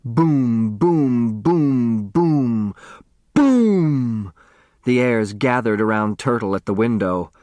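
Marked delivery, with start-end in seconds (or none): 0.0-4.3 imitated booms. A narrator's reading. Fireworks bursting.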